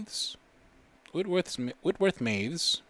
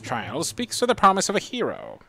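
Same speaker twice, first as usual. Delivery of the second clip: a hint of a solemn old monk, louder and higher, reading his blessing; 4 semitones higher, 6 dB louder than usual. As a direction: lightly voice the grand old monk bestowing the orb. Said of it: "what does this old dude talk sound like? Let's choose the voice".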